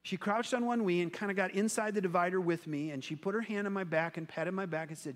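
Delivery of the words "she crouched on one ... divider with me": ordinary delivery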